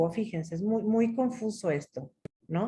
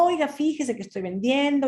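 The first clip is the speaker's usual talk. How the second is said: higher-pitched salesman pitch; 6 semitones higher than usual. Retold or imitated. Acted salesman pitching encyclopedias.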